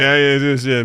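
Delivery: ordinary talk, nothing marked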